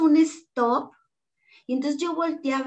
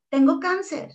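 blurted out; 5 semitones higher than usual. Retold or imitated. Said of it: "también se lo solté de esa manera".

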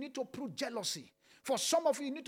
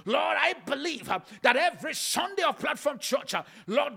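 loud, raised proclamation; preaching shout; 3 semitones higher, 8 dB louder than usual. Acted. Pastor declaring the church's future, shouting.

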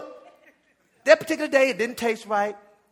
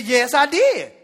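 loud public retort; loud talking; 5 semitones higher, 9 dB louder, slower than usual. Retold or imitated. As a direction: say it loud so everyone hears. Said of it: "he's loud talking me too now".

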